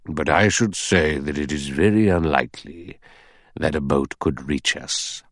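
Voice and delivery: very slow, deliberate judicial pronouncement; thoughtful old judge. Acted. Judge doubting a boat will come, slow and deliberate.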